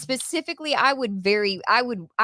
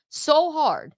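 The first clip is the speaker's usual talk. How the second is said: HARD pushed out with higher pitch; emphatic repetition; 7 semitones higher than usual. Real, not acted. Host says how hard they'd have punched, emphatic. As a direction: repeat it higher, leaning on SO.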